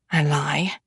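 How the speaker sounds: usual speaking voice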